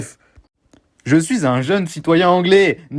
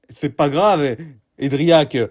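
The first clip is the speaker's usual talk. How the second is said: ordinary delivery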